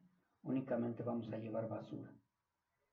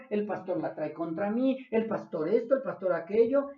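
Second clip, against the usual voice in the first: fiery preaching; 5 semitones higher, 9 dB louder than usual. Acted. Preaching loudly to the congregation.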